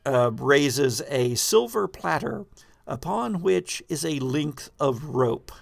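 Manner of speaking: normal talking voice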